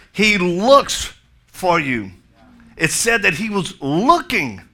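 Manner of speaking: loud, high, emphatic sermon delivery; impassioned preaching. Real, not acted. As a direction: preach it out with big stressed words. Preaches prodigal son at full force.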